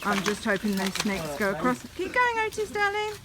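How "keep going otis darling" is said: encouraging. Real, not acted. Encouraging toddler on path.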